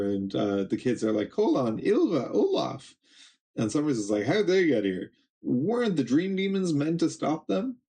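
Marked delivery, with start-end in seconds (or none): 1.3-3.0 put-on much higher, hopeful child voices; excited kids calling. Kids calling to the warrior giants, high eager shout. 4.2-7.8 higher, startled voice; alarmed villain. Acted. Summers seeing the giants arrive, startled and higher.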